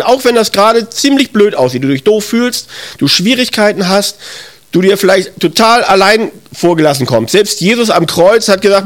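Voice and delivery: ordinary delivery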